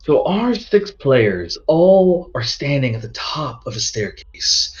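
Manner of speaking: ordinary delivery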